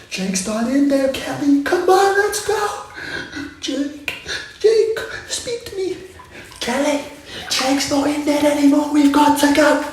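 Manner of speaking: hammed-up horror-movie panic; Kelly pulling a friend away from zombie Jake; panicked movie survivor. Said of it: "'cause you always hear".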